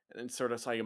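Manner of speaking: ordinary delivery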